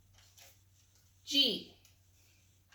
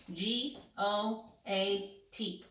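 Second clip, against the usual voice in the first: usual speaking voice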